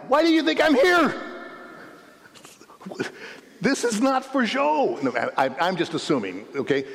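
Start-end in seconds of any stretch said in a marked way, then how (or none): 0.1-5.0 paralytic's voice. Pretend. The paralytic defending himself, high and indignant. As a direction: insist he came for healing, voice up high.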